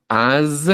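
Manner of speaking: "As" ending in a buzzing z; Z sonora final. Shown as a demonstration. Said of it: "me enfoco en hacer la Z". ("As" con z sonora al final)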